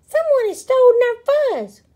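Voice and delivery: high, loud, quick outcry; alarmed cry. A narrator's reading. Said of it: "cried Pipsqueak".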